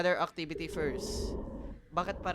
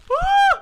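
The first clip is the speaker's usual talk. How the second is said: scream; 15 dB louder than usual. Real, not acted. Startled by Weeping Angel.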